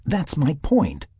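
drawn-out, high-pitched emphasis; insistent. Acted. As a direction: insist that this is exactly it. Sarah insists on her point.

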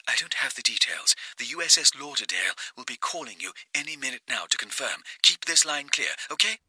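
hushed, quick whisper. Acted. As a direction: whisper the update fast. March's voice, hushed.

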